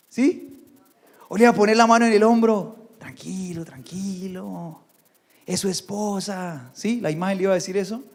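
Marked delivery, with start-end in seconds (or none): 3.0-6.7 much softer, slow soothing murmur; calm him down gently, hand on his shoulder; soothing hush. Pretend. Silver idol hushing Micaía during the fight, soft and slow.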